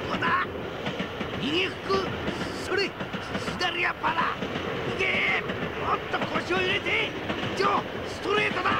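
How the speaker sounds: high, eager cheering shouts; excited ringside coaching. Acted. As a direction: call out each punch like a trainer. Danpei cheers Joe's punches.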